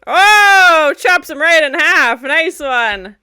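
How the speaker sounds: loud shout of amazement, easing off; shocked delight. Real, not acted. Viewer cheering as he gets chopped in half, shocked delight. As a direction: yell the "Oh", then cheer the hit. Reacting to a kill.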